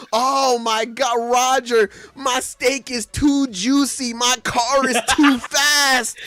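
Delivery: hammed-up first-world anguish; mock agonized lament. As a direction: moan about luxury as if it were torture. Acted mock complaint of luxuries.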